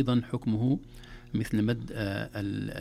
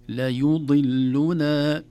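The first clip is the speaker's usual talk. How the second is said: loud, very slow recited word; Quran recitation; 3 semitones higher, 8 dB louder, much slower than usual. Shown as a demonstration. Teacher modelling successive vowels, loud slow recitation. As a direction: chant it syllable by syllable.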